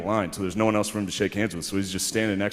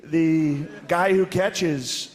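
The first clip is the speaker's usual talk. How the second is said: higher, very slow mock Trump voice; Trump impression; 7 semitones higher, much slower than usual. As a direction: drawl it out like Trump talking to athletes. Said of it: "he's like".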